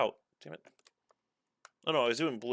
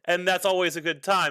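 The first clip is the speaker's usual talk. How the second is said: fast reading aloud; 5 semitones higher, faster than usual. Retold or imitated. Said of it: "I was just like".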